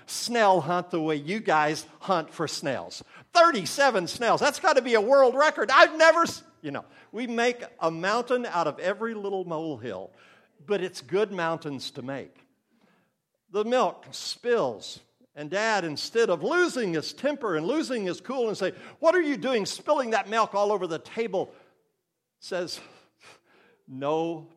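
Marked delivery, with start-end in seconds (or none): 3.3-6.4 much higher and louder, gushing delight; excited praise. Retold or imitated. Grandparent praising snail hunt. 19.0-21.5 a louder, higher mock outburst; a dad snapping over spilled milk; mild imitation of a dad losing his cool; angry dad.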